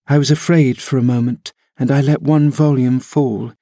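usual speaking voice

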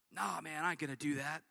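low, soft, offhand brush-off. Acted. Peter brushing off Jesus, low and dismissive. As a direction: shrug it off like a cocky friend.

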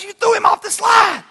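put-on high, loud teen accusation; shocked daughter. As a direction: gasp it out at your dad. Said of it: "She goes".

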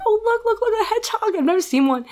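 re-enacted high, squealy excitement; excited delight. Woman shows partner her first hedgehog, high excited delight. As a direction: point it out eagerly, pitch way up.

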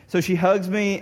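usual speaking voice

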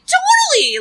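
pubescent voice cracking way up; teen boy's voice cracking high on "Totally!". Retold or imitated. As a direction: squeak the word as the voice breaks. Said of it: "he's like a cracked voice through puberty".

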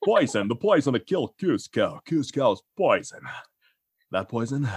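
dim henchman puzzling it out; Patrick Warburton as Kronk. Acted. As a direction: play a dopey henchman thinking aloud. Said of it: "Patrick Warburton, definitely".

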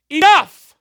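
Enough! shouted in full fury; furious shout. Acted. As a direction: cut him off with one loud, angry word. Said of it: "And I think he's upset".

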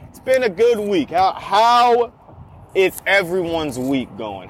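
usual speaking voice

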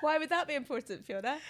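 asking through laughter; laughing. Real, not acted. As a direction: laugh while you ask. Laughing question after a silly tip.

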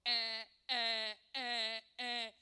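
repeated blaring alarm bleats; smoke alarm. Retold or imitated. Smoke alarm going off, imitated blaring beeps. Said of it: "the smoke alarm starts going off".

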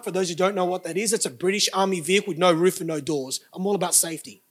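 ordinary delivery

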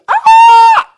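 put-on very high loud child's scream; imitated child scream. Father imitating his son's playground scream, piercing. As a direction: shriek like a startled little boy. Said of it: "major scream from a small child".

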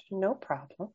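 usual speaking voice

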